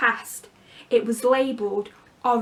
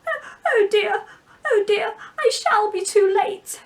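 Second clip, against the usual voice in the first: higher, louder, a frantic crying rabbit; 10 semitones higher, 5 dB louder than usual. Acted. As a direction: panic like a rabbit running late. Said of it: "hear the rabbit say to itself".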